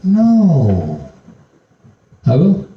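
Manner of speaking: high, loud, pressing sermon voice; full-force pulpit preaching; fervent preaching. Acted. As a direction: preach it up to the congregation with fire.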